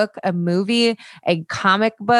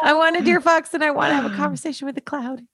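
laughing; 7 semitones higher than usual. Real, not acted. Laughing joy over Hilda.

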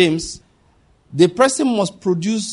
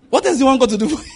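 fast, higher, pushed challenge; rhetorical challenge; 7 semitones higher, much faster than usual. Real, not acted. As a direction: fire it at the crowd quickly. Preacher challenges congregation.

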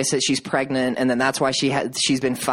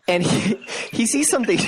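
real laughter breaking into the words; higher, laughing too hard to finish; cracking up; 10 semitones higher than usual. Real, not acted. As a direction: try to tell it while losing it to giggles. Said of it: "can't even get this out".

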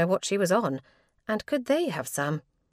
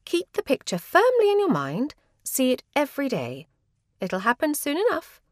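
lightly voiced bossy sister, pitched higher; eager sister; 5 semitones higher, 3 dB louder than usual. Sister selling Minnie on visualization, lightly voiced eager sister. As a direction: gush encouragement like a keen older sister.

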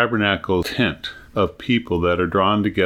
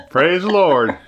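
higher-pitched mock rider's cry; playful raised call; playful exclamation; 8 semitones higher, 3 dB louder than usual. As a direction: echo the joke with a raised voice. Echoing the joke's rider cry.

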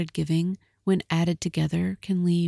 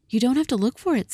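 quick, higher, urgent reply; 7 semitones higher, faster than usual. Acted. Della rushing to answer her husband, urgent. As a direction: rush to reassure him.

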